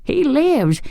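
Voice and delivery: high witchy shriek of triumph; delighted scream. A narrator's reading. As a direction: squeal it, frantic with delight. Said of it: "she screamed".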